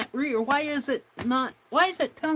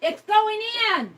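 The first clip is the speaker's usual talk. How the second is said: higher, louder, delighted relief; excited; 9 semitones higher, 7 dB louder than usual. Real, not acted. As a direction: cheer as it finally works. Printer finally works, delighted.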